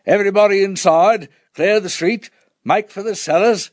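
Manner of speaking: high, loud warden's street call; booming through megaphone. A narrator's reading. Warden clearing the street, booming through a megaphone. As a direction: boom out orders as if through a megaphone. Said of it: "booming through a megaphone".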